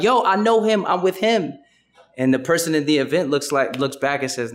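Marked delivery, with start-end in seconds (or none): 0.0-1.5 shouting out. Retold or imitated. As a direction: call out to be let in. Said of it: "shouting out".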